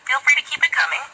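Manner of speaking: ordinary talk, nothing marked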